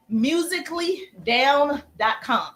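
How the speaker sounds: slow, spaced "musically down dot com"; slow dictation. Shown as a demonstration. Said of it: "write that down".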